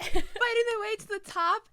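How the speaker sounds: loud, high, crying-laughing; real overcome gush; teary excitement. Real, not acted. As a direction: burst out with emotion.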